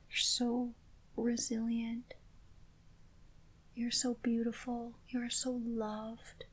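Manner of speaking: ordinary talk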